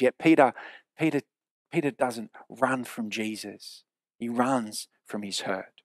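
ordinary talk

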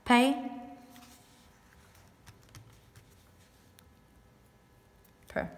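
"pay" lifted, long pause, then "per"; syllables apart. Shown as a demonstration. Teacher sounding out the open syllable, "pay" and "per" far apart. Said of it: "that makes it an open syllable".

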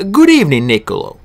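sudden loud, high call of greeting, then dropping; the magician hailing his guest; Dee's greeting. Acted. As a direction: greet him without turning, bright and loud. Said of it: "Dee said without turning around".

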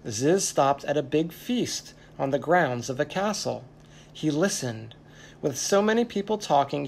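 plain reading voice

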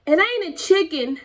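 put-on high, loud little-girl retort; answering back. A narrator's reading. Girl answering papa back insisting on her dog. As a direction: talk back like a stubborn kid. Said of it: "Lola May answered back".

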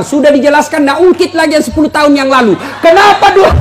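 put-on preacher venting about wives reopening old fights; mock exasperated shout. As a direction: yell it like a fed-up husband. Mocking wife's old grievances.

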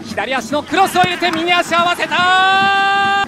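loud, drawn-out high call; excited goal call. Real, not acted. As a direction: stretch the call out as it scores. Goal call in match commentary.

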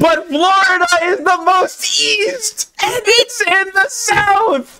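high, whiny protest; whiny complaint. Real, not acted. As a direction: whine it like it's unfair. Whiny outraged argument.

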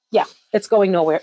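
ordinary delivery

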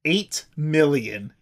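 drawn-out, slow deadpan reveal; slow dramatic reveal. Acted. Host teasing co-host with the answer, slow reveal. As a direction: let each word land slowly.

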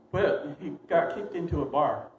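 ordinary talk, nothing marked

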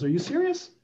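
high-pitched retold disbelief; incredulous. Dad's friend asking back after a bold pitch, incredulous. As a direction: imitate an amused, disbelieving reply.

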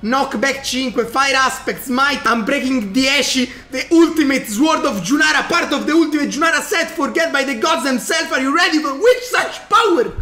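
high, loud epic item reveal; hyped announcement. Acted. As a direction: announce it like a legendary prize. Reads sword stats grandly.

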